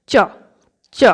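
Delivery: চ as tense double ch; hold, then release চ as cch; doubled চ. Shown as a demonstration. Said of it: "এরম একটা উচ্চারণ হবে". (চ-এর জোড়া উচ্চারণ, চ্চ)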